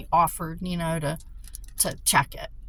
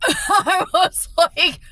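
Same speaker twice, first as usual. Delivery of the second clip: real laughter; 10 semitones higher, 7 dB louder, much slower than usual. Real, not acted. Bursting into laughter at the breaker.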